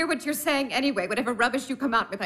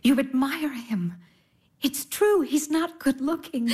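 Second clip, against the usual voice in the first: usual speaking voice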